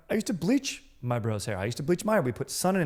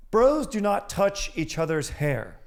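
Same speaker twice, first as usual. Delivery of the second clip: high, slow proclaiming read; formal proclamation; 9 semitones higher, 4 dB louder, slower than usual. Pretend. Host reading out the article of the Bro Code, proclaiming. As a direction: announce it like a solemn law.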